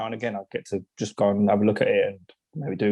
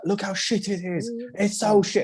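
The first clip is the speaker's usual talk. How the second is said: high-pitched retold moaning; exasperated complaint; 9 semitones higher than usual. Him showing her the failed chair, exasperated and high. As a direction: re-enact a worked-up complaint.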